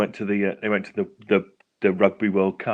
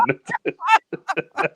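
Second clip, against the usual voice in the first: high burst of laughter; big laugh. Real, not acted. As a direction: crack up at the joke. Laughing at friend's lottery joke.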